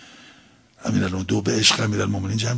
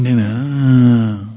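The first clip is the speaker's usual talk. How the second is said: telling no; 5 dB louder, much slower than usual. Shown as a demonstration. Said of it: "ولی ببین".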